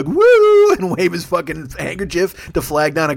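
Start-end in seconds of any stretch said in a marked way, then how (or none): 0.0-0.7 long, loud falsetto woo; high fluting hoot, hammed up; Oscar Wilde. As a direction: trill out a dandyish cry. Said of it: "like, Oscar Wilde would go".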